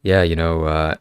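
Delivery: normal talking voice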